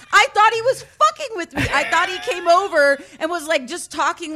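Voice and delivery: loud, high laughing disbelief; excited, laughing. Real, not acted. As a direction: tell it cracking up. Laughing disbelief while telling.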